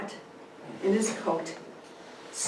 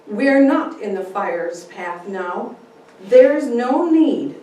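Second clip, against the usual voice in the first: firm steady refusal; 3 semitones higher, 11 dB louder, slower than usual. Acted. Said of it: "looking at him squarely".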